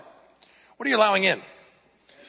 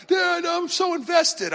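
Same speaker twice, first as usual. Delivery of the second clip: put-on much higher, slow pleading teen daughter voice; whiny daughter; 14 semitones higher, slower than usual. Father retelling his daughter protesting the anime ban, high whine. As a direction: whine it like a teen girl begging dad.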